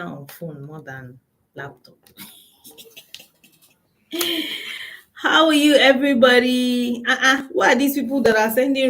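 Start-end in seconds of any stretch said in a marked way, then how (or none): 4.1-5.0 high breathy giggle; a quick amused laugh. Real, not acted. Host laughing at viewers joining live.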